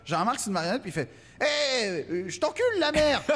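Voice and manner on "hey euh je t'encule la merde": high crude puppet voice; mock ventriloquist dummy swearing; foul-mouthed puppet. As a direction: spit insults like a rude puppet. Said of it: "Fait juste dire des insanités".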